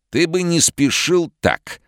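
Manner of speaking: high, slow, sinister booming voice; sinister other voice. Acted. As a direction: let a menacing stranger speak through him. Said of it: "другим зловещим голосом".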